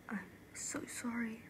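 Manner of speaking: very soft, remorseful sorry; quiet and guilty; hushed apology. Acted. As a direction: apologise almost in a whisper. Apology after the confession.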